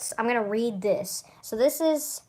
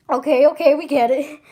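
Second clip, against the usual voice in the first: higher, louder exasperated cut-in; impatient friend; 4 semitones higher, 8 dB louder than usual. Acted. A friend interrupting the long warning, exasperated. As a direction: cut the speech short, fed up. Another character cuts in.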